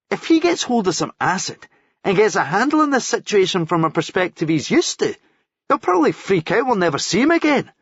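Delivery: lightly voiced worried mate; Paul warning. A narrator's reading. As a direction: warn them it could all go badly. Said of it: "Paul warned".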